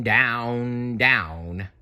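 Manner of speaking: ordinary delivery, nothing marked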